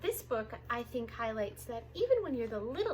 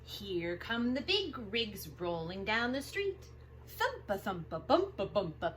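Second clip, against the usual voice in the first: lively picture-book read-aloud; reading to children. Acted. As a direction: read it big and bouncy for little kids.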